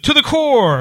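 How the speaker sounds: high, punchy toast shout; mock crowd yell. Retelling the crowd's reply, high mock shout. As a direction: answer the toast like the whole room. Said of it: "everyone responds with".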